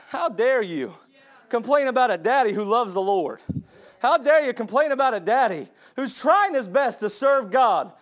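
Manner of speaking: fiery rebuke. Acted. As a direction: rebuke the teenagers hard. Preaching at full force.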